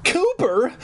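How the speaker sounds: high, joyful call; happy cry. A narrator's reading. Mom running to her son, high joyful call. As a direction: call out with relief. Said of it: "my mom cried happily".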